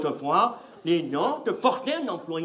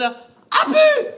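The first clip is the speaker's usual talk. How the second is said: very high, loud bye; farewell shout; 9 dB louder than usual. Acted. Comedian yells goodbye as he leaves the stage. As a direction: yell it as you exit.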